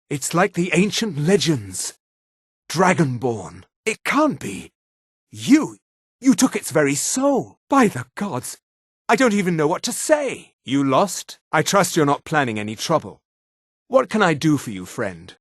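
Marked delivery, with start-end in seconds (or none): 3.8-4.7 higher-pitched, awestruck gasp of words; stunned disbelief. Acted. Witness learns of the Dragonborn. 5.3-10.5 high-pitched, astonished awe; marvel, at a loss before a wonder; awestruck. Acted. Witness amazed by absorbed dragon soul.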